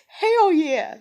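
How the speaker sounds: laughing, high and loud "Hell yeah"; gleeful laugh. Retold or imitated. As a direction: cackle with delight. Gleeful retold reaction.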